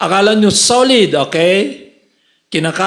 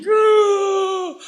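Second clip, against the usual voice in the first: mock wailing cry; 12 semitones higher than usual. Wife crying at bedside.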